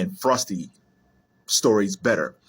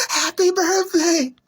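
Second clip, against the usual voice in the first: very high, drawn-out cartoon snowman cry; Frosty the Snowman; 3 dB louder, slower than usual. Fan quoting the cartoon snowman's catchphrase, high and drawn out. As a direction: stretch it out in a high, gleeful put-on voice. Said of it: "he comes back with the top hat".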